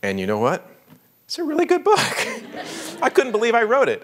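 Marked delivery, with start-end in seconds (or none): 1.2-3.0 laughing delight. Real, not acted. Author amazed rereading his own book, laughing. 3.0-4.0 amazed. Real, not acted. Amazed at own book.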